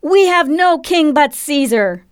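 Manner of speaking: slow, high crowd cry; high, drawn-out declaration; shrieked back. A narrator's reading. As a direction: cry it back defiantly as a mob. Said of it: "The crowd shrieked back".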